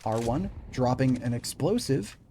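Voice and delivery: energetic esports caster voice; play-by-play commentary. Acted. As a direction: call the round like a live caster. Casting live esports match.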